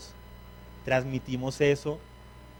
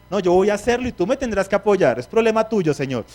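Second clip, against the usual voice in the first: demanding; 5 semitones higher, 5 dB louder than usual. Acted. Preacher acts out demanding believer.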